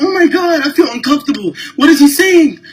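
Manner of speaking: high, shrill-sounding mock alarm, loud; mocking a panicked lady. Imitating a passenger who is alarmed by Arabic, high and loud. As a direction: ham up a frightened passenger. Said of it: "one lady was like".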